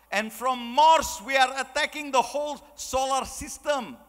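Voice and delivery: put-on high, slow, emphatic Russian movie accent. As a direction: draw it out like a James Bond film Russian. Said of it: "if you think that's a good accent".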